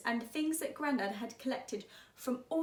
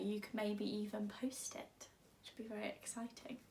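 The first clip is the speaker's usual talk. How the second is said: whisper; 3 semitones lower, 10 dB quieter than usual. Real, not acted. Teacher aside to pupils.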